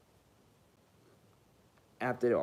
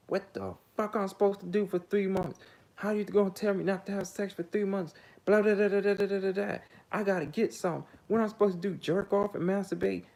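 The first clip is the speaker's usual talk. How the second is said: high, mocking outraged female voice; hammed-up indignant woman ranting; 9 semitones higher than usual. Mocking the woman's outraged reply, high and indignant.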